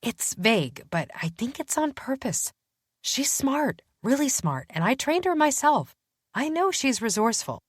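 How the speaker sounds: walk him through her reasoning with growing excitement; detective convincing her partner about a clue; Danny, animated. Acted. Danny explaining the hidden message.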